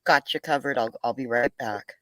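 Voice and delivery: normal talking voice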